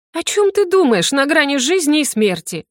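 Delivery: high, incredulous inner rebuke; indignant self-scolding. A narrator's reading. Heroine to herself on death's edge, indignant. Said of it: "возмутилась про себя".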